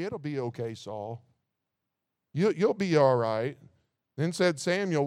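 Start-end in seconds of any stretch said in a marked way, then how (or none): 0.0-3.6 much softer, gentle mock reassurance; mock comforting. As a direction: pat him on the hand in a hushed, soothing tone. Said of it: "pat him on the hand and say".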